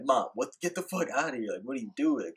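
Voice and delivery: mildly heated mock outburst at mom; retold snap. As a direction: imitate a friend snapping at his mother. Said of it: "you're snapping".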